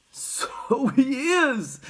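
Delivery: put-on high, very slow old man; stretched, wondering old-man voice; Grandpa Joe. A narrator's reading. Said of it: "said Grandpa Joe".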